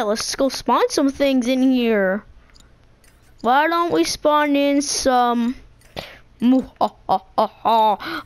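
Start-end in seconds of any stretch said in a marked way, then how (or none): 6.4-8.2 lower, mock villain cackle; evil laugh. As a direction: cackle like a cartoon baddie. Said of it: "I'm so evil".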